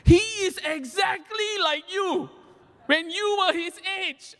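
the mother shouting blame at her son; a high-pitched shouted scolding from mom. Retold or imitated. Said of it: "scolded me".

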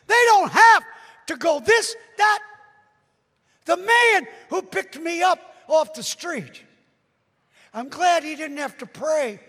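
high, loud emphatic pulpit shout; impassioned loud preacher; full-force preaching. Real, not acted. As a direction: drive it home to the congregation.

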